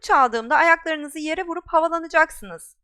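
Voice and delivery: high, loud commanding teacher; brisk instructor's command; stern flying instructor. Acted. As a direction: bark orders at the class.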